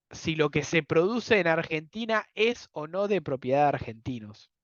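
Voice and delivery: normal talking voice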